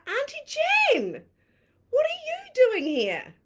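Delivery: high, loud and slow call of surprise; calling out surprised. Retold or imitated. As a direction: call out across the driveway, delighted. Lady recognizes nana, calls out.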